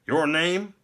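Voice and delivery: high, stiff guard's questioning; officious gatekeeper. A narrator's reading. Stiff gate warden questions visitor.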